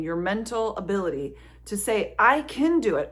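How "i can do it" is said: a louder, higher burst of self-belief; rallying yourself, half mock; pep talk. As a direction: pump yourself up.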